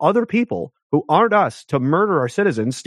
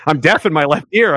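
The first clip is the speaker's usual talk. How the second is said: usual speaking voice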